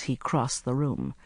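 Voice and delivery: plain reading voice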